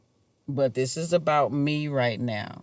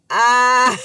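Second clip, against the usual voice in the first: loud burst of real laughter, higher; laughing; 6 semitones higher, 9 dB louder than usual. Real, not acted. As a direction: crack up at your own joke, loud. Laughing at own joke.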